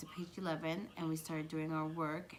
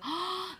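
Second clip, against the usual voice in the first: high, sharp mock gasp; shocked gasp; 12 semitones higher, 4 dB louder than usual. Retelling her shocked reaction.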